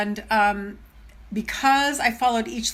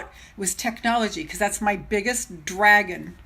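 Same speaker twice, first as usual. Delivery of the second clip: usual speaking voice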